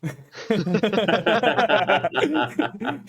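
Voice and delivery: a high, loud burst of laughter; delighted laugh. Real, not acted. Game master laughing at his own character, delighted laugh. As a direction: crack up at the bit. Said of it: "laughing at his own voice".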